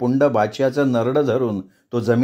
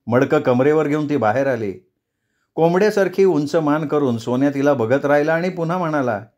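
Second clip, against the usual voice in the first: ordinary delivery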